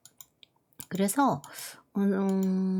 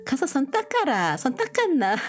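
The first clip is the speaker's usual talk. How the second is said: Masan dialect; 8 semitones higher than usual. Retold or imitated. Masan neighbor mom telling her kid to wash hands in dialect. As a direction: imitate a Masan mother checking on her kid. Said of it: "이렇게 말씀하시더라고요".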